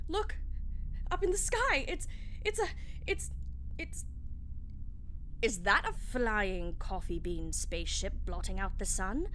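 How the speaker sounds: high, loud stammering cry; alarmed shouting. Acted. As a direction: point and shout in terror. Crowd seeing spaceship.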